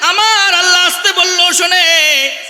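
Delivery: high, loud impassioned sermon voice; preaching at full force. Acted. Preacher to his flock, high and loud. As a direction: cry it out over the crowd.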